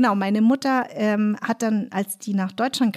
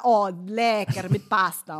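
Italian waiter; slower than usual. Retold or imitated. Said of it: "weil Italiener immer".

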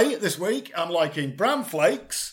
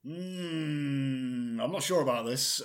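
ordinary talk

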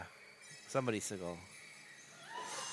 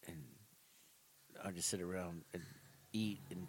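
mock mopey Seagal; 6 semitones lower, 16 dB quieter than usual. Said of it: "He's like".

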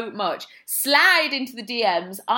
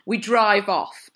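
usual speaking voice

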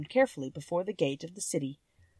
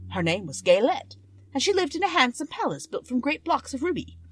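storytelling king, a touch higher than the narration; lightly voiced Monkey King telling his tale; 6 semitones higher, 7 dB louder than usual. Monkey King tells Dorothy his history, lightly voiced. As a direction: spin the old tale, lively in places.

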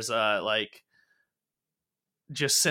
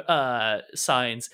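usual speaking voice

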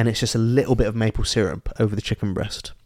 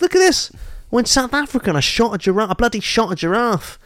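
high, excitable comic bragging; boasting cousin; 9 semitones higher than usual. Acted. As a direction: show off the trophy, pitched right up. Voicing cousin showing off his kill.

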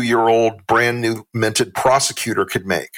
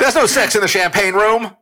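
high, loud put-on voice quoting a film line; movie quote; 10 semitones higher, 3 dB louder than usual. Host quoting a movie line in a high, loud mock voice. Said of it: "Sorry, movie quote".

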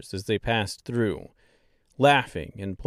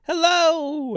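much higher, chirpy little Munchkin hello; cheery Munchkin shout; 5 dB louder than usual. Acted. Munchkins greet travelers from windows, high cheery call. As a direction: call out like a tiny villager. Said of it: "shouted things like".

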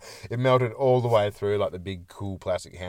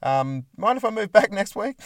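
higher, mock-sheepish request; imagined salesman; 9 semitones higher, 4 dB louder than usual. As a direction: lightly play a grown son asking Mum a favour. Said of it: "What'd you do today, Larry?".

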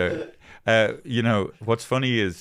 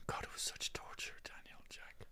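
hushed, voiceless confiding; whisper; 23 dB quieter than usual. Real, not acted. Telling co-hosts about a torturous trip, pained whisper. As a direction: whisper it as if worn out by the memory.

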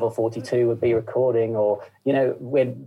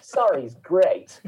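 mock posh schoolboy, higher pitched and drawn out; posh little boarder greeting his Cockney dad; posh boarding-school accent; 5 semitones higher than usual. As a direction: put on a hammed-up posh English accent, amused. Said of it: "sends me off to boarding school".

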